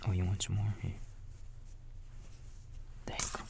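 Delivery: usual speaking voice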